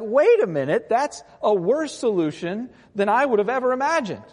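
high, loud mock disbelief; incredulous Habakkuk. Prophet objects to God's worse solution, loudly incredulous.

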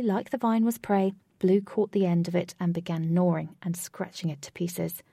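plain reading voice

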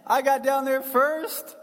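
boastful John. Retold or imitated. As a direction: brag like a kid who won the race. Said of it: "he's like".

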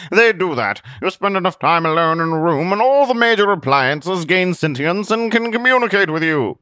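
high, loud matter-of-fact hermit; lightly voiced hermit. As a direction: explain it as an odd recluse. Zealot explaining.

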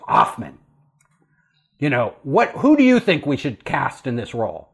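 lightly voiced tough Hollywood producer; imposing boss. Producer putting the new hire on the spot, low and gruff.

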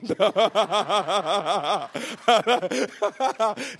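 long amused laugh. Real, not acted. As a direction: laugh it off at the joke. Laughs at own joke.

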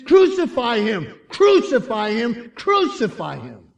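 loud, high chant, easing at the end; angry crowd yell. Acted. Crowd demanding an execution, yelling angrily. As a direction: yell it like a hostile mob. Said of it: "the yelling of an angry crowd".

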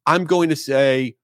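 ordinary delivery

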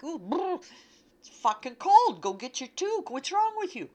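high, loud shivering scold; scolding body voice. Retold or imitated. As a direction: yell at yourself from the cold.

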